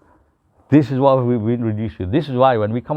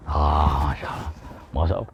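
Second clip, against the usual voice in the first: contented sigh; 8 semitones lower, 8 dB quieter than usual. Retold or imitated. Satisfied sigh after eating.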